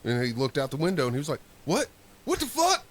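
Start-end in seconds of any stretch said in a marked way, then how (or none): 1.7-2.8 shocked disbelief. Retold or imitated. Coworker sees his bike missing.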